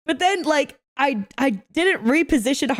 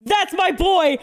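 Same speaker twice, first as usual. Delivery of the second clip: excited cheer; 6 semitones higher, slower than usual. Real, not acted. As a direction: cheer him on like a proud fan. Cheering player's great play.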